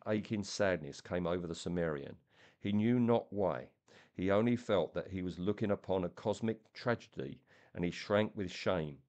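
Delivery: steady storytelling voice, reading aloud; story narration. A narrator's reading. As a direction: draw the listener into the tale.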